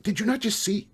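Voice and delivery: high, loud, incredulous repeat; indignant echo. A narrator's reading. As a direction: throw it back in outraged disbelief.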